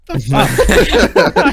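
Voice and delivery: high, loud, drawn-out reaction through laughter; laughing mock outrage, stretched out; laughing disbelief. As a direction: act out baffled outrage while cracking up. Laughing at assistant's absurd misunderstanding.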